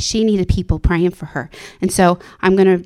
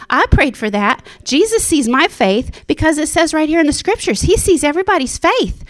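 joyful realisation; 6 semitones higher than usual. Retold or imitated. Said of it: "And I'm like".

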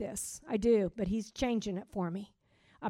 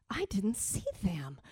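hushed, softer whisper to herself; startled whisper; 3 semitones lower, 8 dB quieter than usual. Retold or imitated. A driver whispering after cutting someone off. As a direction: breathe the words out in shock. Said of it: "and I'm like".

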